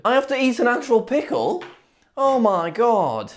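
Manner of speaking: dismayed. Real, not acted. Dreading eating a pickle.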